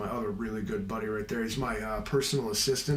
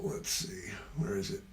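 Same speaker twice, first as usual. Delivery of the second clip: whisper; 5 semitones lower, 8 dB quieter than usual. Real, not acted. Searching settings, muttering to self.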